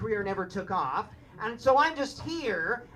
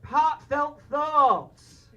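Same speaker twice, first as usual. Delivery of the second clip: slow, drawn-out mock northern English accent; 3 semitones higher, slower than usual. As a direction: stretch out an exaggerated North of England accent. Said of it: "only express them like this".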